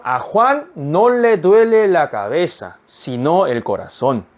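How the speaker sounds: very slow, careful Spanish sentence; slow teacher read-aloud; slow model reading. Shown as a demonstration. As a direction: read it slowly so students can follow. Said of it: "한 번 더요".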